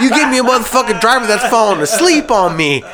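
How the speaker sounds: high-pitched retold outrage; indignant complaint. Driver blaming his sleeping partner to the boss, indignant.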